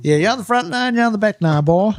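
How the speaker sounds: high, sing-song marshal calling out; lightly mocked course attendant; attendant's call. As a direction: chant it like the usher at the gate. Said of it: "repeating the attendant's call".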